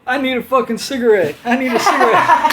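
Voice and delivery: frantic half-laughing craving; desperate demand. Retold or imitated. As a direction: beg for a smoke like you're losing it. Drunk after bar fight, demanding.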